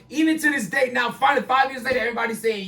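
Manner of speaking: ordinary talk, nothing marked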